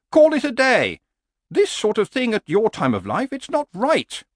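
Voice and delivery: high-pitched horse, worrying aloud; nagging horse. Acted. Horse objects to the man's plan, high and nagging. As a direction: voice a fretful horse, pitched well up.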